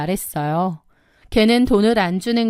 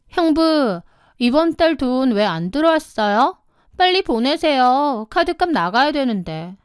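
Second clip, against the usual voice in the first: higher-pitched sister-in-law voice, pressing; demanding younger sister; 4 semitones higher than usual. Acted. Sister-in-law texting brother-in-law for money, demanding. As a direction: nag him in a higher, entitled voice. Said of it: "제 여동생이더라고요".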